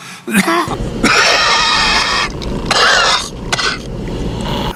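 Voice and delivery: long, forceful hacking cough; real sustained coughing; coughing fit. Real, not acted. Coughing fit interrupts speech.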